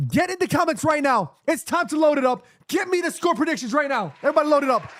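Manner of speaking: high, urgent hype-man call. Real, not acted. Streamer demands score predictions from the chat, hyped up.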